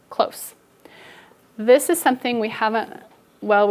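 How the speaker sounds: normal talking voice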